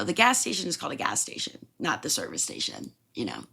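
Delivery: ordinary talk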